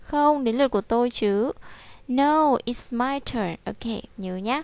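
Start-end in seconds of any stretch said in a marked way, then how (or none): 2.1-3.5 very slow, each word spaced out; slow, clear teacher model of "No, it's my turn"; slow model reading. Shown as a demonstration. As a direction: say it slowly for the class. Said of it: "teacher models slowly".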